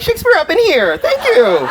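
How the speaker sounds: high, pleased mock boast; smug self-praise. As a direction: preen like a proud author. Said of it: "I was like".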